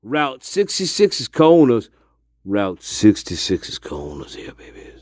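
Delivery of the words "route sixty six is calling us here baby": softer sultry tease. Pretend. Sultry teasing sign-off.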